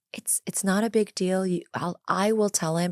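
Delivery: soft, gentle reassurance; quietly putting a worried parent at ease; kindly, sweet therapist. Retold or imitated. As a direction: imitate a kind therapist, hushed and soothing. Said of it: "the therapist very kindly and sweetly said".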